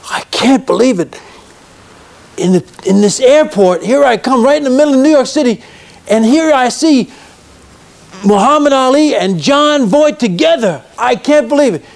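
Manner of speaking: amazed fan. Retold or imitated. Fan meets two stars in an airport, amazed. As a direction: act out a passer-by who can't believe their luck. Retold passer-by amazed to see both.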